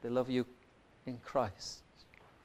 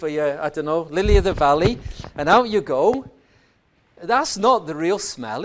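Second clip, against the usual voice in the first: raised, ringing pulpit voice, higher and louder; fervent preacher's delivery; full-force preaching; 7 semitones higher, 7 dB louder than usual. Acted. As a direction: drive it home to the congregation.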